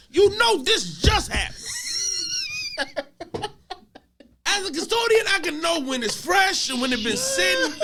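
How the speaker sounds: high-pitched shouted rant, close to laughing; exasperated disbelief. Real, not acted. Custodian insisting to a friend the mess was fresh, incredulous shouting.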